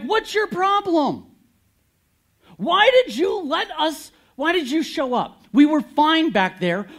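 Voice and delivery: loud, high, furious shout at God; angry complaint. Acted. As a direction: yell at God like betrayed people. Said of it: "They got ticked at God".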